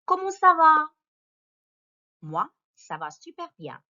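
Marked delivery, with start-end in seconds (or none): 0.0-0.9 high, bright-toned lesson opener; cheery greeting. Acted. Teacher greeting pupils, high and loud. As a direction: sing out a cheery hello to the class.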